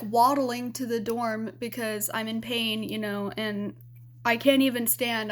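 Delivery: ordinary delivery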